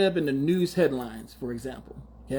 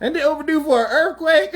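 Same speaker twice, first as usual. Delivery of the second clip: laughing jab; 10 semitones higher, 4 dB louder than usual. Real, not acted. Joking wish about LA rival.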